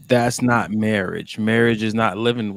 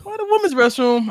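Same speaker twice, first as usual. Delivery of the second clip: put-on high confused kid voice. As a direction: squeak it like a puzzled child. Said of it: "I'm like".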